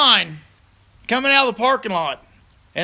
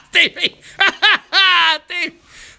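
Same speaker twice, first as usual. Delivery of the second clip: high giggling fit while calling Stevie; cackling laugh teasing his buddy; 9 semitones higher, much slower than usual. Real, not acted. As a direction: crack up mid-tease, much higher.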